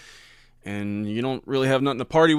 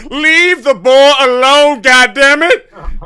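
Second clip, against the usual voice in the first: very high, slow, loud put-on grump; mock old-man protest; 14 dB louder, much slower than usual. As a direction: drag it out like a cranky granddad. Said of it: "And I'm like".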